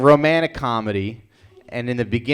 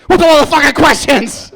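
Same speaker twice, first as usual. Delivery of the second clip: higher, louder, laughing mock rage; mock angry outburst; 14 semitones higher, 8 dB louder than usual. Customer on steroids snapping at the barista, laughing mock rage. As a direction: blow up at the barista in a joking fit.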